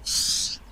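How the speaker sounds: long unvoiced hush; urgent shush. Hushes the friend's outburst.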